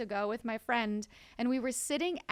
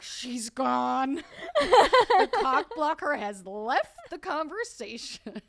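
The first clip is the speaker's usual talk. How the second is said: slow, loud laughing gloat; mocking dudes; 3 semitones higher, 10 dB louder, much slower than usual. Dudes at the bar gloating, slow and laughing. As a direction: gloat like triumphant guys. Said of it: "they were like".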